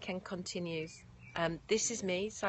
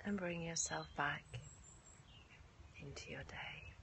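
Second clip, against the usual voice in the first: guided meditation; 9 dB quieter than usual. Acted. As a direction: guide the class gently into rest. Leads yoga nidra, then softly closes.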